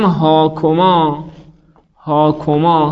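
هاکما said very slowly as hɔkomɑ; slowly sounded-out هاکما; slow model form. Shown as a demonstration. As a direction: stretch every syllable of هاکما.

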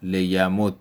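لیمدد said as "liyamuddu", doubled d ending in u; لیمدد as lesson demo, "li-ya-mud-du"; Arabic doubled dal with damma. Shown as a demonstration. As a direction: read لیمدد with a geminated dd and final u. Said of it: "سه صورت پیدا کرد".

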